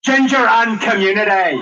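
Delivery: loud-ish, high, drawn-out Belfast accent. Shown as a demonstration. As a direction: hit a slow, heavy Belfast accent. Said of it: "phrase I use to get the Belfast accent".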